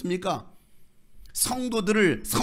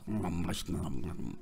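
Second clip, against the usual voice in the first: laag, zacht smakgeluidje van genot; nagebootst smullend kind; 10 semitones lower, 7 dB quieter than usual. Retold or imitated. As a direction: doe zacht een tevreden etend kind na. Imitates child relishing dirty food.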